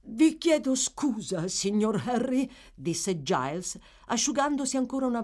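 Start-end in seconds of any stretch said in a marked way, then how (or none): 0.1-2.5 lightly voiced apologetic servant, slow and high; humble manservant. Servant apologising to his young master, deferential. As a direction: beg pardon deferentially.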